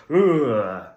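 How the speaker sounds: loud grunted "ugh"; short, loud vocal tic, imitated; imitated tic noise. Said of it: "his Tourette's made him go".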